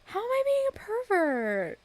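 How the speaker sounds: high, loud indignant shriek. Real, not acted. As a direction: shriek in mock outrage. Outraged reaction to chat.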